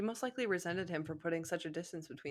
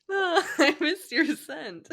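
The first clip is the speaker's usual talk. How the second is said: slow, loud mock-romantic line through laughter; laughing mock romance; 8 semitones higher, 10 dB louder, much slower than usual. Joking with her friend about the fanfic, laughing mock romance. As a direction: ham up a swooning lover, laughing.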